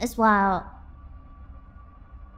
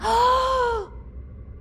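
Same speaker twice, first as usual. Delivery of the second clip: long, high gasp of amazement; drawn-out thrilled intake; amazed gasp; 10 semitones higher than usual. Real, not acted. Amazed at tulpa explanation.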